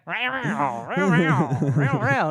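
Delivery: high, loud growling row; jaguar voice. Retold or imitated. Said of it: "making that Jaguar noise".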